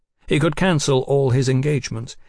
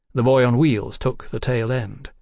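ordinary delivery

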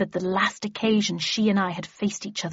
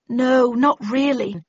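ordinary delivery, nothing marked

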